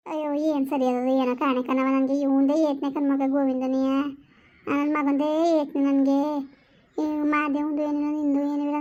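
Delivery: slow, drawn-out mother's lament; an old mother grieving for her son. Acted. Old mother lamenting her son's fate to her daughter, slowly.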